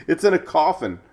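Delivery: ordinary talk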